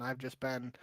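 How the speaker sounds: normal talking voice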